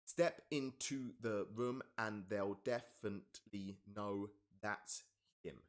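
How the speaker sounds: very slow, each syllable spaced out; slow syllable-by-syllable read; counting syllables slowly. Shown as a demonstration. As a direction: tap out every syllable of the line.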